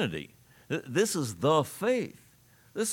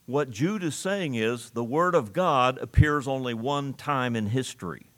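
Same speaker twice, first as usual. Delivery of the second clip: ordinary talk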